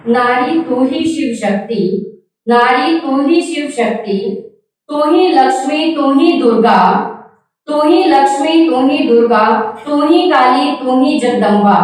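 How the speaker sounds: lilting verse in short, falling phrases; poem recitation. Acted. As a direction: recite rhythmically, lifting then dropping each phrase.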